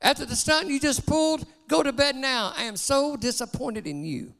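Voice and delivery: a mild mock scolding; father sends his son to bed; angry father. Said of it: "screamed the dad".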